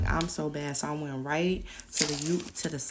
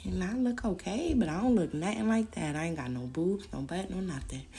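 ordinary talk

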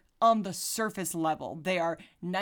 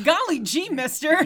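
put-on high, loud, laughing gee-whiz kid; hammed-up golly gee kid; wholesome 1950s kid; 8 semitones higher, 7 dB louder than usual. Mocking 1950s sitcom wholesomeness.

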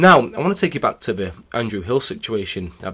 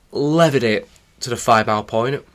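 ordinary delivery

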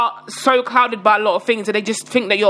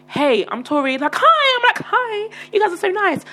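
acted polite greeting; 10 semitones higher than usual. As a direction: imitate a sweet, high greeting to strangers. Approaching strangers with a greeting.

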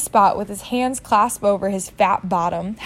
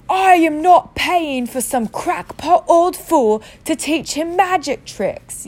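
high, slow, louder furious yell; 7 semitones higher, 4 dB louder, slower than usual. Acted. Uncle Vernon yells insulting Dumbledore, high and slow. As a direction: bellow it in outrage. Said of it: "yelled Uncle Vernon".

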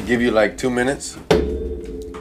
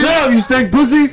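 mock comedian snapping at a heckler; high, loud put-down from the stage; roasting a heckler; 4 dB louder than usual.

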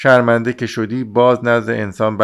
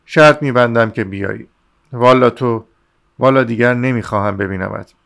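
ordinary delivery, nothing marked

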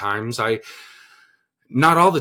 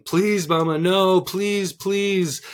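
mock pleading child, pitched higher; a hammed-up whiny plea for mercy; imitating child begging; 7 semitones higher, much slower than usual. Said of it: "of begging, crying".

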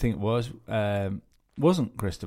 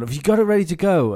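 high, loud voice for the guy asking; 8 semitones higher, 7 dB louder than usual. Retold or imitated. Retold exchange, guy asking.

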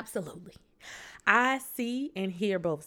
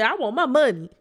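loud, higher, insistent demand; demanding shout; 5 semitones higher, 11 dB louder, faster than usual. Retold or imitated. Girlfriend yelling at her boyfriend for her money back. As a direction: push it out as a sharp demand.

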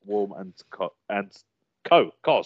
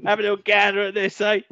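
put-on light Cockney accent, a bit higher; hammed-up Cockney geezer offering a look; Cockney impression; 9 semitones higher, faster than usual. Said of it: "if I do an impression of Cockney".

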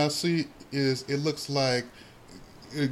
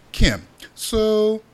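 ordinary talk, nothing marked

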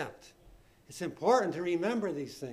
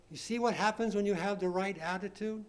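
ordinary delivery

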